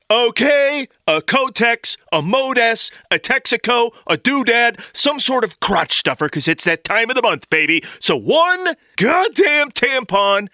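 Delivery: high, slow emphatic rant; exasperated rant. Acted. Fed-up customer lectures the pharmacist, high and slow.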